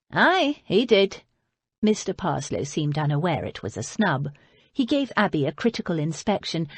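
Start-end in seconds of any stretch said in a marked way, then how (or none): 0.1-1.2 put-on a light northern accent, a plain "Aye"; lightly voiced northern father, a bit louder; broad northern accent. A narrator's reading. Mister Parslow to Lady Beatrice, a lightly voiced northern reply. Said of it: "broad northern accent".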